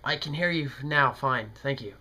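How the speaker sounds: ordinary talk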